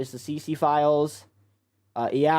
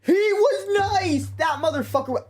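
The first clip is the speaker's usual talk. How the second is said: outraged exclamation; 4 dB louder than usual. Real, not acted. Outraged at the clip.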